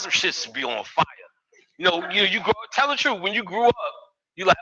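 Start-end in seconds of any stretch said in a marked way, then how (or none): none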